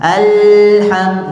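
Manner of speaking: exaggerated drawn-out Alham; overdone. Shown as a demonstration. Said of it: "tidak perlu begitu".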